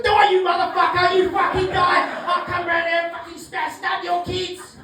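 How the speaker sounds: high, loud threatening yob; mock menacing heckler; aggressive stranger. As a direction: scream threats at the comedian. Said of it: "And someone goes".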